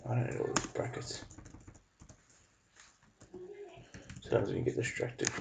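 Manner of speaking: soft unvoiced mutter under breath; muttering to self. Real, not acted. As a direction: mutter it quietly to yourself. Muttering to himself at computer.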